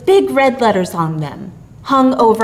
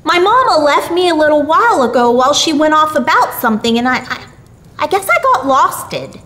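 put-on high, quick, faltering child voice; little lost boy; 6 semitones higher, 3 dB louder, faster than usual. A narrator's reading. Billy tells Santa he got lost, in a high hurried child voice.

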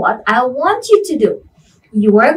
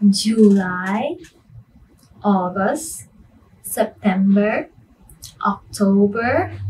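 sing song rote recitation; 4 dB quieter than usual. Shown as a demonstration. Teacher reciting months to class.

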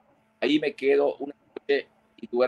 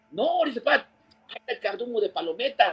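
high mock voice; 11 semitones higher, 4 dB quieter than usual. Retold reply in high voice.

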